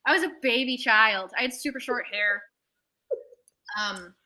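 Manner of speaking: normal talking voice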